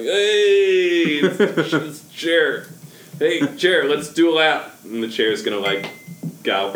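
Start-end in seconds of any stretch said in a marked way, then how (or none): 0.0-4.7 flustered, high, loud showman; overbright kids party host. Acted. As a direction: stumble in then sell the magic. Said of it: "be like".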